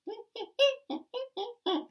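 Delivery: high, squeaky monkey laugh; cheeky monkey cackle; monkey chatter. Acted. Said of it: "monkeys ... said".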